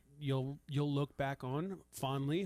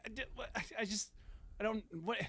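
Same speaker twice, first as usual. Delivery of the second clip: higher, stammering mock bewilderment; mock-flustered, pitched-up false starts; flustered reenactment; 8 semitones higher, 3 dB quieter, faster than usual. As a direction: stumble over the start, acting put on the spot. Acts out being stumped by question.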